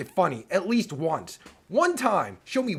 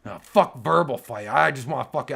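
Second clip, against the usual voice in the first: ordinary delivery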